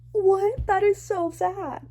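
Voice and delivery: a high, loud what, then a slow sad line; shocked and sad. Real, not acted. Reader hearing the saddest gift line, high and shocked. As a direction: gasp at how sad it is.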